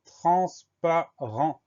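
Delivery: "transparent" slowly, with each syllable set apart. Shown as a demonstration. Teacher dictating a hard word to pupils, syllable by syllable. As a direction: break "transparent" into slow, separate syllables.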